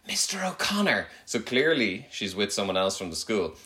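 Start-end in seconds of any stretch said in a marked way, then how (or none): 0.1-1.1 put-on child's whisper quote. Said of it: "I overheard her say".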